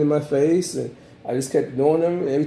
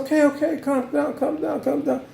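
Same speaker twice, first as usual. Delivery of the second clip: much higher, pleading calm down; mock soothing panic; 12 semitones higher than usual. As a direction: beg him to calm down in a high voice. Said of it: "but I said".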